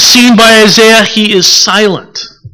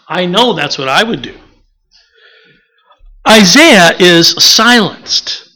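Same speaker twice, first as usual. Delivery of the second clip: ordinary talk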